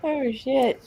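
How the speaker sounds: normal talking voice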